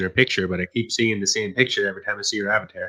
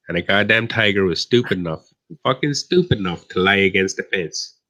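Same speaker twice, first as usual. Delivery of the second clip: usual speaking voice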